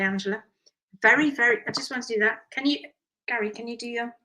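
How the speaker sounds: ordinary talk, nothing marked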